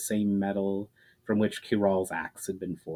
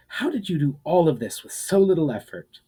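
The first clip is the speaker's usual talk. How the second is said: higher, pressing demand for an answer; 7 semitones higher, 4 dB louder than usual. A narrator's reading. Ushara demanding answers from the man, pressed hard. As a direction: insist on knowing, push hard. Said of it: "she demanded".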